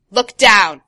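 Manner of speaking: loud barked order; shouted command. Acted. Crow orders the boy to look down, loud shout. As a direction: shout it in all caps. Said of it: "All caps".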